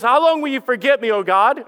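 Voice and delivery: loud, high, exasperated question; anguished plea. Retold or imitated. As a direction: demand an answer from God. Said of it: "did you see how he says".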